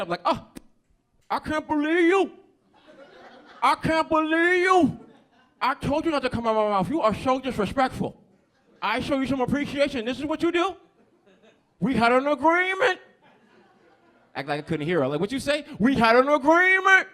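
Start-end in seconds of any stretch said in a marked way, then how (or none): 0.2-13.0 high, loud outraged shriek; pissed-off woman. Acted. Comic as angry lady friend confronting him, high furious yelling. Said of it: "she was pissed". 15.2-15.6 high, loud query; pretending not to hear. Man acting like he couldn't hear her, loud high question. 15.8-17.1 high, loud outraged shriek; pissed-off woman. Acted. Comic as angry lady friend confronting him, high furious yelling. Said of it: "she was pissed".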